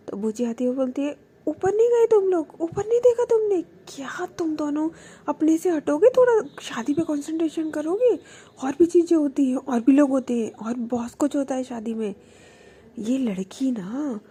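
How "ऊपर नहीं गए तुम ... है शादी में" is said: loud, high exasperated telling-off; aunt loudly scolding the dreamy couple. Retold or imitated. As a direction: scold two distracted kids.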